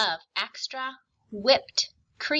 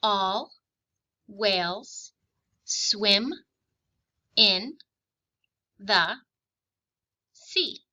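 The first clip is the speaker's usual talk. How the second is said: each word spoken slowly with long gaps; slow, separated dictation reading; much slower than usual. Shown as a demonstration. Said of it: "I will read a sentence slowly two times".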